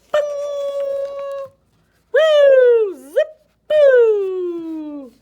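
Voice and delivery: high, loud swooping cries; playful whoops for a falling snake; whooshing sound effects. A narrator's reading. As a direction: sound out each wild swing, loud and high. Snake swinging through trees.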